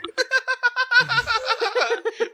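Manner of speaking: long high laugh; high burst of laughter. Real, not acted. Reacts to meth joke.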